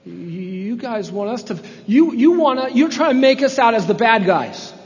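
high, loud, offended protest; wounded tone. Acted. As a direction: sound injured and defensive. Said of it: "That's a tone of injury".

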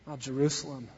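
soft, low grieving cry; grieving lament. Acted. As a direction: mourn the city gently. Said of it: "vein of sadness and grief and compassion".

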